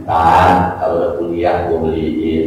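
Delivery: low-pitched dad putting off a request; low, offhand imitation of a dad; father's voice. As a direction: play a father fobbing off his child, low. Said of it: "Maka kata bapaknya".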